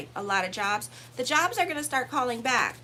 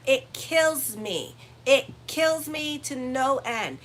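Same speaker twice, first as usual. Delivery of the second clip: drawn-out, louder, leaning on kills; slow emphatic exasperation; 4 dB louder, much slower than usual. Real, not acted. Astrologer venting about Libras, slow and louder. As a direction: stretch it out, exasperated. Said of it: "Only Libras do that".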